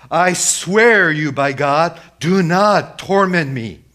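high, loud, drawn-out plea; acted demon begging loudly; demon crying out. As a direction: cry out slowly at full voice. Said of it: "This is the demon speaking to Jesus".

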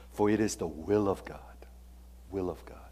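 hushed whisper of the verse; whispered scripture. Real, not acted. Preacher whispering the verse to the congregation. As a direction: drop to a whisper for God's will.

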